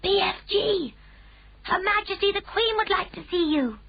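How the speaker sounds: put-on high girl's voice calling across the garden. A narrator's reading. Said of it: "called out".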